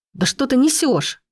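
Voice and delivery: high-pitched incredulous protest; losing patience, not shouting; exasperated outburst. A narrator's reading. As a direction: snap in disbelief, pitch jumping up. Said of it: "не выдержал я".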